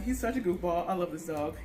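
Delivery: ordinary talk, nothing marked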